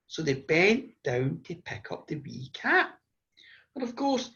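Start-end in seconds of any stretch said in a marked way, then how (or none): none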